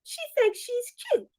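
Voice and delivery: high, sneering gossip voice; catty girlfriends. Acted. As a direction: sniff at her like jealous friends. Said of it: "they're gonna say".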